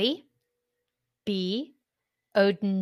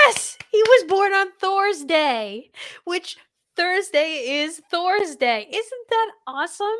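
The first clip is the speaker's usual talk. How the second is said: excited; 9 semitones higher, 4 dB louder, slower than usual. Real, not acted. Thrilled by the Thor's Day fact.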